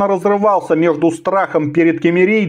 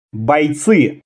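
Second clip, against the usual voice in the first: plain reading voice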